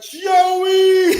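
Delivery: high, loud, drawn-out yell of a name; alarmed shout. Retold or imitated. Teammates calling to their friend mid-fight, alarmed shout. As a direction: cry out to stop a friend.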